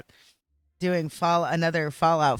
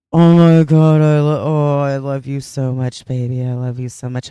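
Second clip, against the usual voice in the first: loud gushing affection; 10 dB louder than usual. Real, not acted.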